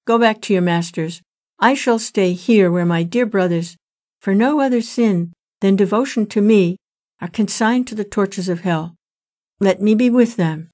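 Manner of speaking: ordinary delivery, nothing marked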